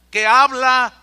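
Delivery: sudden loud burst on que habla; preached shout. Real, not acted. Pastor to congregation, the Spirit speaks, shouted. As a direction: belt it out from the pulpit.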